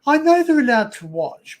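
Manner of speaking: snapping back at a friend's correction; defensive retort. Real, not acted. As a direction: push back, a bit irritated.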